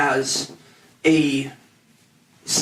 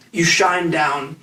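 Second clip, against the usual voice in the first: normal talking voice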